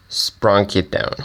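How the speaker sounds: normal talking voice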